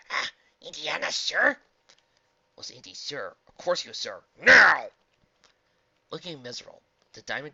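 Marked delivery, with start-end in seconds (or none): 0.1-1.6 high, loud anxious question; worried. A narrator's reading. Nervous dog checking with the archaeologist, worried. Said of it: "Flint looked worried". 4.4-4.9 sudden high, loud yell; angry shout. A narrator's reading. Archaeologist ordering the dog to obey, angry shout.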